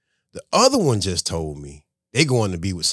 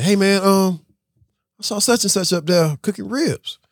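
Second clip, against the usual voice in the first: retelling his own question; 11 semitones higher than usual. Retold or imitated. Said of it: "I say".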